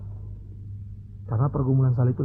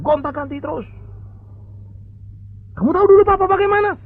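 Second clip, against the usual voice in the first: angry father scolding. Acted. Angry rich father lecturing his lazy son, shouting.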